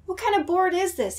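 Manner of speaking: baffled. Acted. Max baffled by board.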